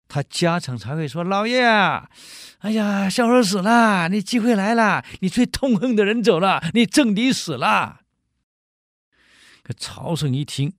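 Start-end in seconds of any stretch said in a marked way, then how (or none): 1.2-8.0 high, loud eager announcement; excited servant. Acted. Servant telling master his enemy died, loud and high. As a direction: gleefully tell your master his rival is gone.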